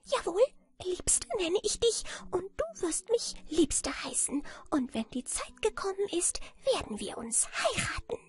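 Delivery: high, squeaky outrage; furious little man. A narrator's reading. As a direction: scold her in a tiny, indignant piping voice. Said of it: "krebsrot vor Wut und schrie".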